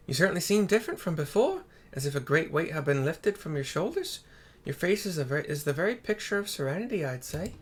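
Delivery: a hint of the little girl's accent; Tataru's accent. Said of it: "Sorry for her accent in my voice".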